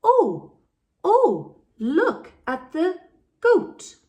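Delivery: very high, loud, slow shocked cry; teacher acting out the children's shock to teach oa; shocked oh sound. Shown as a demonstration. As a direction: gasp it out like a startled child. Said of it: "the sound we learn is".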